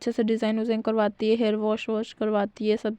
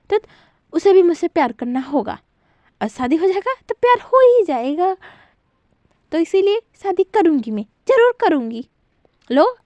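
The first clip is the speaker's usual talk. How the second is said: higher, louder harsh telling-off; a mother shutting her daughter out; angry scolding mother; 3 semitones higher, 5 dB louder than usual. Acted. Mother berates Lucy over the phone, higher and louder.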